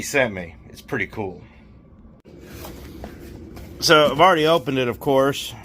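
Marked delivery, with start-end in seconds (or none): none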